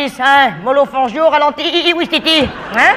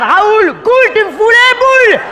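mocking rhyme; 8 semitones higher, 4 dB louder, slower than usual. Rhyming jab at a joker.

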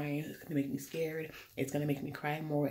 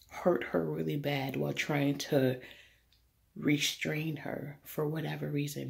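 normal talking voice

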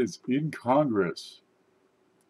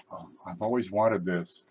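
usual speaking voice

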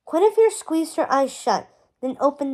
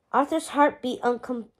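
plain reading voice

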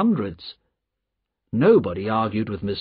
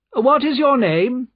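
high, loud aristocratic question; imperious nobleman; haughty Marquis; 12 semitones higher, 5 dB louder than usual. A narrator's reading. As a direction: demand it loftily, high and loud.

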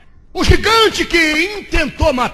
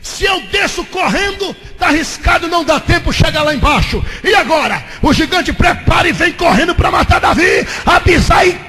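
loud, high, shouting sermon delivery; fiery Pentecostal preacher shouting the story; full-force preaching; 6 semitones higher, 8 dB louder than usual. Acted. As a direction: preach it out, building to a roar.

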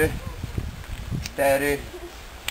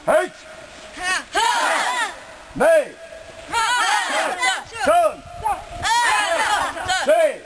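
shouted dojo commands; shouted drill count; much slower than usual. Real, not acted. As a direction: call the count like a sensei.

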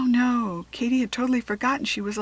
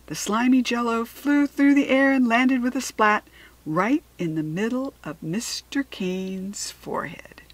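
usual speaking voice

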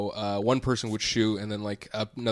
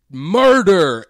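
loud, higher yell of a man at the range; imitated yell; 6 semitones higher, 13 dB louder than usual. Imitating a stranger yelling before he fires, loud yell. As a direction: bellow it out, long and high, as he did. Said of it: "and he would yell".

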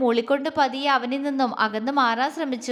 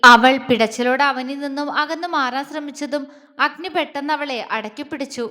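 plain reading voice

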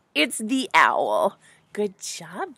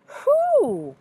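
high-pitched, drawn-out questioning bear; bear asking who; 4 dB louder than usual. A narrator's reading. Bear asks who's down there, high and wondering. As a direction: ask it high, then let it fall. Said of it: "And the bear asks".

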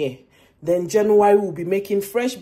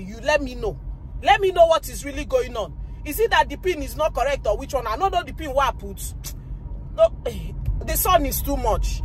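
higher, heated ranting at the bank; angry rant; 6 semitones higher than usual. Real, not acted. Vlogger venting about the bank's card troubles, real angry rant. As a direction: vent real frustration at the bank staff. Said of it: "Now, imagine when I'm angry".

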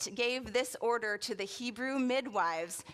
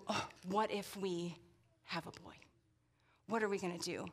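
soft, hushed fearful murmur; worried mother; 4 semitones lower, 8 dB quieter than usual. Pretend. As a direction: voice a mother's dread quietly. Pregnant mother's imagined worry.

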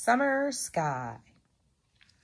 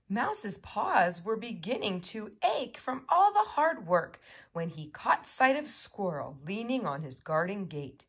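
plain reading voice

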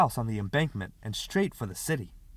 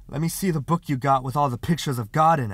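breathless; 3 semitones higher, 7 dB louder than usual. A narrator's reading. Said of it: "Parker said breathlessly".

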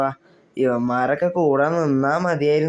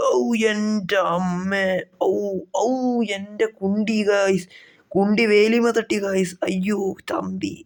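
mock pain groans; 5 semitones higher, slower than usual. Hurt after crashing the bike.

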